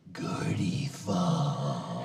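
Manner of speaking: slow, drawn-out theatrical whisper; mock-dramatic whispered name call; Bill's whispered name. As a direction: hush it out slowly like a stage secret. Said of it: "as Bill used to say".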